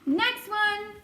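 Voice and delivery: high, brisk summons; shouted call. A narrator's reading. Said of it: "he shouted".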